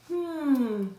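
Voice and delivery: long, falling thoughtful hum; pondering. A narrator's reading. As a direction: hum slowly as dad considers it. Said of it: "he was thinking it over".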